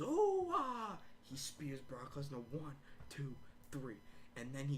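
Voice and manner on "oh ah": high, loud drawn-out cry; hammed-up high battle yell; imitated wrestler's yell. Paul Heyman before he spears Brock, high mock yell. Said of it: "he goes".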